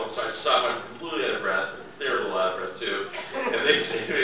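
ordinary delivery

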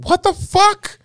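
delighted shock in a high squeak; high thrilled disbelief. Real, not acted. Waiter learning diners work at Transworld, squealing disbelief.

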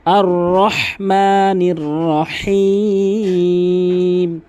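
slow chanted রহমানির রহিম with throaty ha; big ha recitation. Shown as a demonstration. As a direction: recite with heavy ha in রহমানির and রহিম, ending held long. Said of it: "এভাবে". (রহমানির রহিম-এ বড় হা, টেনে তেলাওয়াত)

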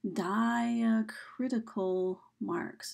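"diacritical marks" very slow, "di" stretched long; slow sounding-out. Shown as a demonstration. Teacher introducing a term to students, "diacritical marks" slowly drawn out.

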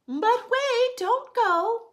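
high, loud call to stop them. Acted. The pet seller calling the customer back, loud and high. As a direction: call them back before they leave.

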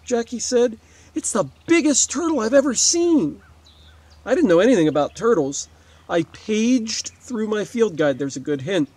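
usual speaking voice

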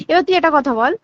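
usual speaking voice

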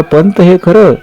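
high, shocked outcry; anguished high-pitched question; narrated cry. A narrator's reading. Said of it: "राजा ओरडला".

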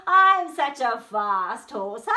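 very loud, drawn-out brag; boastful horse. Acted. Horse sure he will beat snail, loud slow boast. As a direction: brag slowly at full volume.

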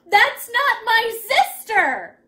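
high, loud, slow outcry; shocked child crying out word by word; horrified gasp. A narrator's reading. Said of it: "he gasped".

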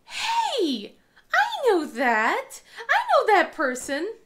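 high, loud, drawn-out discovery; spotting mother-in-law online, high and loud; surprised recognition. Retold or imitated. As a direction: gasp it out in delight, slow. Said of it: "I go".

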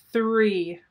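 loud countdown announcement in a lightly put-on computer voice; blaring computer voice. The contraption's speaker starts the countdown, lightly robotic and loud. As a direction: blare out the count like a machine. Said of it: "A scratchy computer voice blared".

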